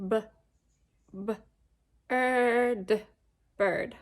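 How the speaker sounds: "B-er-d" split into sounds, then "bird" said whole; teacher sounding out bird; sounding out a word. Shown as a demonstration. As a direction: stretch out B, er, d before "bird". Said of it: "Our next word is bird".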